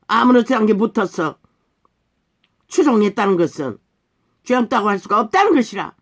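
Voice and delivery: heated, accusing pulpit tirade; indignant preaching. Real, not acted. Preacher accusing the followers of sharing the crime, indignant preaching. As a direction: hammer each accusation at the congregation.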